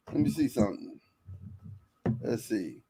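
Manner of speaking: low, soft self-talk; quiet, low aside while looking; muttering to himself. Real, not acted. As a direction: drop the voice while checking something. Looking something up mid-show.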